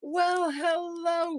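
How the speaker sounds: high, drawn-out Southern greeting; hammed-up Southern counter lady; Southern accent. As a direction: greet them in a slow, sing-out Florida Southern accent. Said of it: "I can't do the accent the way they do it down here".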